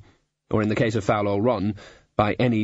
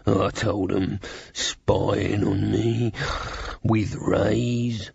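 put-on foul old Ron; much slower than usual. A narrator's reading. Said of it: "said foul old Ron".

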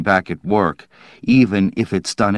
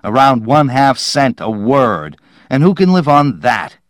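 higher, indignant rant bursting out loud; disgusted outburst; 5 semitones higher, 5 dB louder than usual. A narrator's reading. Dowland refusing to write science fiction, disgusted outburst. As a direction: spit out the contempt, voice raised. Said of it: "Disgustedly, he started toward the stairs".

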